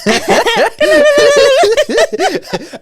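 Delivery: shrieking laugh; loud high laugh. Real, not acted. Laughing at drum joke.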